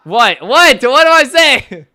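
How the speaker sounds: high, loud shouted questions; shouting, pitch jumping up; loud exclaiming. Real, not acted. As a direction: yell it out in disbelief at the chat. Exclaims loudly at the chat.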